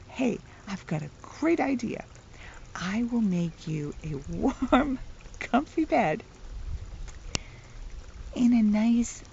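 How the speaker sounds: usual speaking voice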